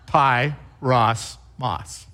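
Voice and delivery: "Pie, Ross, moss" with a short break after each syllable; "Pie", "Ross", "moss" chopped apart, one by one. Shown as a demonstration. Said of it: "syllable by syllable".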